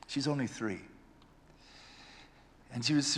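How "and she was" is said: ordinary talk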